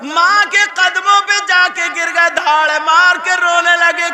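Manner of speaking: high, loud, slow wailing sermon half sung; tearful preaching. Acted. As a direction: preach the son's grief at full force. Preacher at full force, crying scene. (ऊँची, धीमी, रोती हुई तक़रीर)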